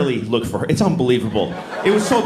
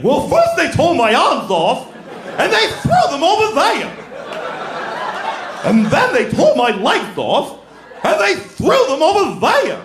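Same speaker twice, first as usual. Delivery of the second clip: over-the-top Scarecrow; 10 semitones higher than usual. Retold or imitated. Scarecrow telling Tin Man about monkeys, high hammed-up wail. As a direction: ham up the Scarecrow's misery. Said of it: "performance that's way over the top".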